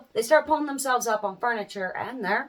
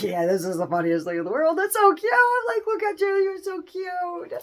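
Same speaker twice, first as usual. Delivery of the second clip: cooing parent; 6 semitones higher than usual. Retold or imitated. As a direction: coo at the baby. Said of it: "They're like".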